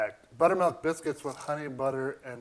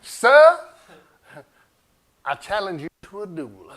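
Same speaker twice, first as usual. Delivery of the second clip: pompous gentleman; 2 semitones higher, 10 dB louder than usual. Acted. Challenging to a duel in jest.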